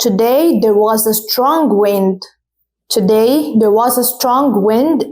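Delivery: today there was a strong wind, x0.4 slow; slow teacher reading; slow model sentence. Shown as a demonstration. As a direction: dictate it very slowly. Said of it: "For example".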